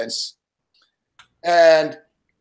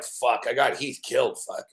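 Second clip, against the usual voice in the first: soft, low guilty groan; guilty mutter; 6 semitones lower, 8 dB quieter than usual. Retold or imitated. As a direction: say it quietly, realizing the blame. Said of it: "I'm like".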